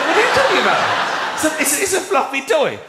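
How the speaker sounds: baffled protest at a silly request; incredulous. Retold or imitated. Comic retells his baffled reply to the producer, incredulous. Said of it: "I'm like".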